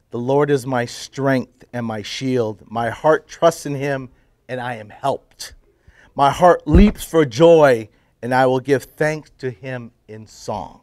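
slow, measured psalm recitation; scripture reading. Acted. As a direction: read the psalm out slowly, phrase by phrase. Reads a psalm aloud.

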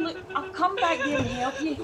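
usual speaking voice

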